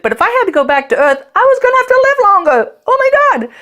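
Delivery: climbing high and fast in laughing disbelief; rising disbelief. Real, not acted. Telling listeners about living longer, in rising laughing disbelief. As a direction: voice shoots up in disbelief.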